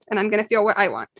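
normal talking voice

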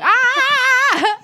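high, loud, drawn-out mock scream. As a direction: scream in comic horror, holding it long. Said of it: "it's like screaming at you".

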